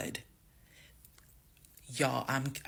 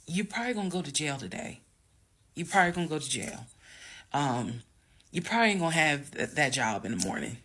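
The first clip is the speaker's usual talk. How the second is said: fast inner warning; faster than usual. Retold or imitated. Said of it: "enough wherewithal to be like".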